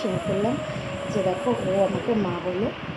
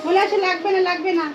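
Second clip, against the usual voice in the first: hawker brush-off; 9 semitones higher, 3 dB louder than usual. Retold or imitated. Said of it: "দৌড় দিয়ে বল".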